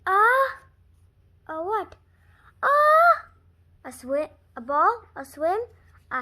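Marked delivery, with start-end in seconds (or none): 2.6-3.1 high loud gasp before a sneeze; building sneeze. Acted. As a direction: inhale sharply as the sneeze builds. Gerald about to sneeze.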